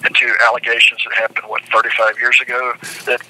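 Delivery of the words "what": ordinary talk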